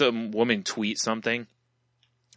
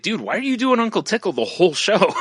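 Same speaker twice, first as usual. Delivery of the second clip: exasperated dude; 10 semitones higher, 4 dB louder than usual. Retold or imitated. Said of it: "I'd be like".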